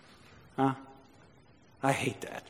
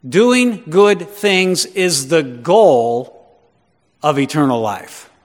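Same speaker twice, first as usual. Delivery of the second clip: slow, loud, weighty declaration; emphatic restatement; 6 dB louder, much slower than usual. Real, not acted. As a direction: drive each phrase home, drawn out and forceful. Preacher restates key phrase emphatically.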